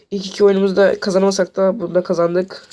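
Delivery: normal talking voice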